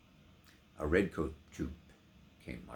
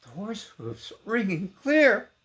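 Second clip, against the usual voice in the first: sudden alarm; 14 semitones higher, 10 dB louder than usual. A narrator's reading. Hoofs heard, excited.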